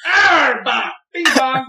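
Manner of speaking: high, loud goofy Pokemon cry; silly shout. Retold or imitated. Wife shouting nonsense after the wedding, high and loud. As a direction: belt the nonsense out. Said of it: "and she's like".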